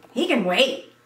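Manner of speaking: mother hotly refusing; hot exclaim. A narrator's reading. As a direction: snap back to overrule her. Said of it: "she exclaimed hotly".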